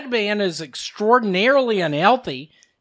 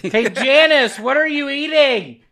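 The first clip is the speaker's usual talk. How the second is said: calling out; 6 semitones higher than usual. Real, not acted. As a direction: call out across the room. Calling out to Janice.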